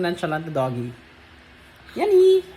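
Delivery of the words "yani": loud high call across the room. Real, not acted. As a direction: shout the dog's name. Calling the dog loudly.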